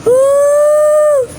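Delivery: high, loud, long ghostly wail; ghost woo. Pretend. Prankster hiding as a ghost wails at the kid, high and loud.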